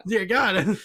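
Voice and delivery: horrified, laughing loudly. Retold or imitated. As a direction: gasp it through laughter. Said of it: "he's like".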